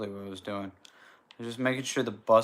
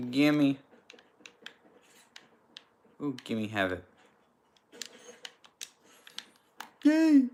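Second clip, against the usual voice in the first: greedy glee; 3 semitones higher, 5 dB louder, slower than usual. Real, not acted. Grabbing an item eagerly.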